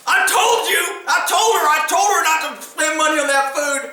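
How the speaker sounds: high-pitched mock rant; angry husband storming in. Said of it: "here comes Billy Bob storming".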